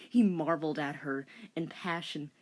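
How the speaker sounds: ordinary talk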